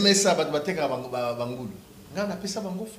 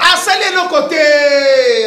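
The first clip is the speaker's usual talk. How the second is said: laughing shout; 5 semitones higher, 6 dB louder, much slower than usual. Real, not acted. Laughing outburst.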